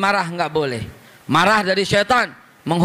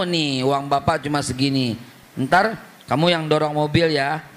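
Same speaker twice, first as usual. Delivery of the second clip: lower, easy-going dad voice; playful father; 6 semitones lower than usual. Retold or imitated. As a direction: tease the kid gently. Father teasing his child.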